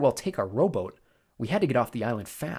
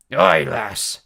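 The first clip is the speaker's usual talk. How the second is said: growl; 9 dB louder than usual. A narrator's reading. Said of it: "the big man growled".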